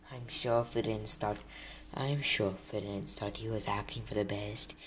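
soft, gloomy mumbled defence. A narrator's reading. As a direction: say it very softly and gloomily. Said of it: "he said in his gloomy voice".

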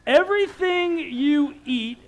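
angry shout. Retold or imitated. Dad shouting at his daughter about the couch, furious. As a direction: blast each everything at her, slowly. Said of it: "I lit up".

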